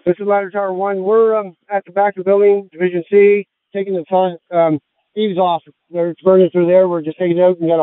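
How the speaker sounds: loud radio report over the roar; shouting over fire noise. Real, not acted. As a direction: call it out loud from the fireground. Firefighter reports from burning building.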